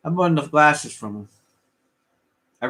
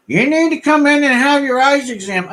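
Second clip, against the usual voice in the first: mimicking the eye doctor; 10 semitones higher, 6 dB louder than usual. Quoting the eye doctor's line.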